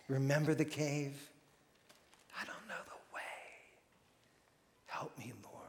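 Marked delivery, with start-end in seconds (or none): 2.4-5.5 whispered plea; a lost soul whispering to God; desperate whisper. Acted. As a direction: breathe it out, barely voiced. Desperate prayer in cave.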